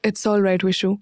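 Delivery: a touch flat and detached; without emotion. A narrator's reading. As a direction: say it with no feeling in it. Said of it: "बिना किसी भाव के कहा". (बिना किसी भाव के, हल्का सा रूखा)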